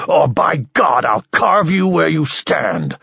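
high, loud screamed demand; furious high shout, challenging a duel; screaming. A narrator's reading. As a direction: scream it at him in fury. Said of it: "screamed Bertol".

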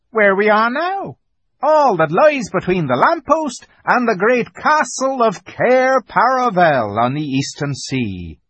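high creature voice, grandly declaiming; Tumnus, grandly explaining. A narrator's reading. Fawn explains Narnia to Lucy, high grand voice. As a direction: proclaim the land in a high fawn voice.

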